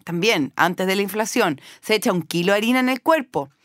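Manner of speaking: normal talking voice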